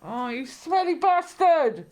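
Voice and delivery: real exaggerated disgust; disgusted outcry. Real, not acted. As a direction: scold the stinky culprit, stretching it out. Reacting to dog's fart.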